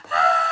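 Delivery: high loud terrified scream. Acted. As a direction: let out a panicked shriek. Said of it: "I screamed and I hollered".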